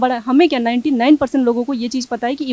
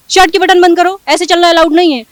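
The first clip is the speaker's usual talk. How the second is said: scolding order; 6 semitones higher, 13 dB louder than usual. Retold or imitated. Staff scolding her dress, retold.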